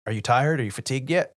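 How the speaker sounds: a hint of the captain on the phone; imitating the captain. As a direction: ask it like a casual captain checking in. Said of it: "he goes like".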